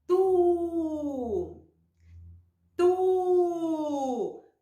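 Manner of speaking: Tu with a long held u; blended syllable demo. Shown as a demonstration. Teacher joining T and U, sounded out. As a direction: draw out each Tu steadily. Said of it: "Vamos juntar as duas?".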